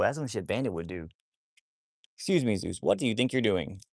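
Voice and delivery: ordinary talk, nothing marked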